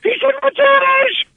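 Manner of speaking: very high, drawn-out excited squeal; giddy disbelief. Retold or imitated. Amazed colleague reacting to Shatner's montage, giddy squeal.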